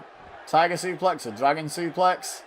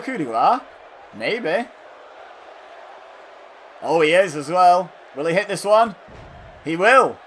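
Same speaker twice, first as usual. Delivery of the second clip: loud, raised play-by-play; excited commentary; 4 semitones higher, 6 dB louder than usual. Real, not acted. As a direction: call the finisher as it happens. Live call of another finishing move.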